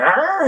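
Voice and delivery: high-pitched little dog snarl; sudden high mock growl; puppy growl. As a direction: imitate a tiny puppy snarling back. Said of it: "that little puppy went".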